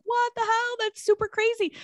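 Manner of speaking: high-pitched, animated amazement; excited retold disbelief; super animated. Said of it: "super animated and was like".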